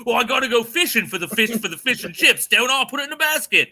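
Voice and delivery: high-pitched, hammed-up English accent; mock English accent. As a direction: play it up in silly English. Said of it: "I do a great English accent".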